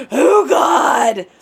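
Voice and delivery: loud, high cry of fright; frightened cry. Retold or imitated. Bat on the floor, she cries out in fright. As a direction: blurt it out in fright.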